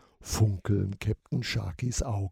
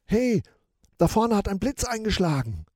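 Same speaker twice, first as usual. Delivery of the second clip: calling out; 9 semitones higher, 5 dB louder, faster than usual. Acted. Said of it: "ruft Michi".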